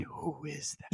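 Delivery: a hushed, scared mimicry of the line. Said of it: "so scared he lost his accent".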